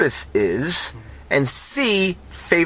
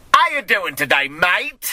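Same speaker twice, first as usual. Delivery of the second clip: higher, broad South London British; amateur British accent, pitched up on a cheeky question; attempted British accent; 4 semitones higher, slower than usual. Shown as a demonstration. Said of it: "I can do a very good British accent".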